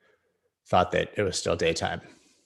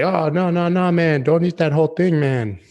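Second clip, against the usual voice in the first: much higher, louder mock Jamaican shout; loud mock Jamaican warning; 10 semitones higher, 9 dB louder than usual. Dealer warns him off the whole cake, loud mock Jamaican. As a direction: yell the warning in a bad Jamaican accent. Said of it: "That was not a Jamaican accent".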